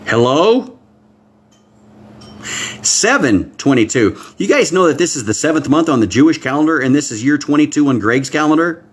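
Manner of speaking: usual speaking voice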